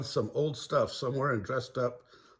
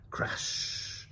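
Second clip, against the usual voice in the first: whispered crash; 7 dB quieter than usual. Acted. Sound effect.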